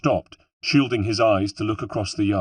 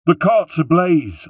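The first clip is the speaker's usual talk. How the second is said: put-on much higher, slow, loud alarm in a Corsican accent; Corsican rebel shout; 9 semitones higher, 8 dB louder, slower than usual. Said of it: "I shouted, this time in ... of a Corsican".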